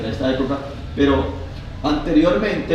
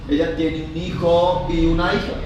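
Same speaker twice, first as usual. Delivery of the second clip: normal talking voice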